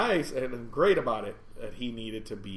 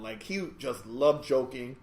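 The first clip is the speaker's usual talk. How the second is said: ordinary talk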